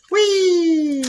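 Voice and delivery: high squealed whee as something flies; playful whee. Real, not acted. Tossing onion skin playfully.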